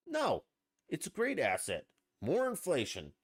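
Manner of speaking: high-pitched mild sarcasm, quoting someone; mock-enthusiastic sarcastic answer. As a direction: imitate a sarcastic retort in short phrases. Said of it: "and this is sarcastically".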